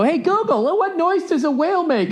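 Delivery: high, cutesy parent voice; mock parent voice. Dad asking Google for his daughter, high cutesy voice. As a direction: ask sweetly like playing with your kid.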